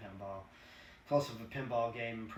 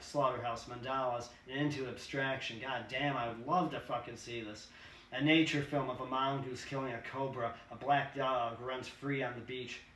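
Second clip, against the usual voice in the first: ordinary delivery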